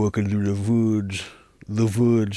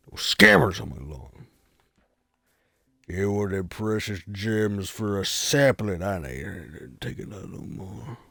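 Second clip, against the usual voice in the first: deranged hermit threat. Pretend. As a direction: menace like an unhinged old hermit, slowing down.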